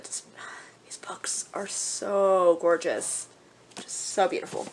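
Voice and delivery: slow awed whisper. Real, not acted. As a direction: whisper in awe of the covers. Admiring book covers, hushed.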